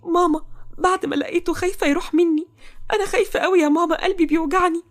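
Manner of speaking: high-pitched, tearful daughter; crying. Acted. Toqa afraid of losing Nouh, crying to her mother. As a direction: sob out fear to mother, pitched well up. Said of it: "تقى وهي بتعيط".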